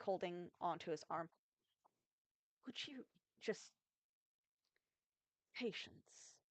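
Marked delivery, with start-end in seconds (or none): 2.7-6.4 queen's hushed rebuke. Acted.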